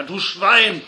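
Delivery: put-on loud, high-pitched drunken-brawl yelling; furious bellow. A narrator's reading. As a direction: bellow the insults at full voice. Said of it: "dann brüllte einer".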